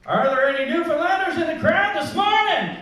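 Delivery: loud call to the crowd. Real, not acted. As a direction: shout it out to the room. Calling out to the crowd.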